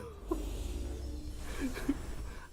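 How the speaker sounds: breathless high giggle; high wheezy laugh. Real, not acted. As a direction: laugh until out of air. Still laughing at joke.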